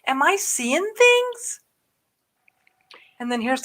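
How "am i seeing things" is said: amazed disbelief. Retold or imitated. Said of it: "I went".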